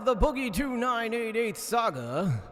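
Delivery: big booming stage announcer; announcer hype intro. Acted. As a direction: announce the star like a boxing MC. Hype intro over music.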